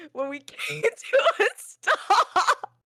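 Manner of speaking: real laughter breaking into the words; slow, high, helpless laughing; cracking up. Real, not acted. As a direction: squeeze the words out through laughter. Teller cracking up at own story.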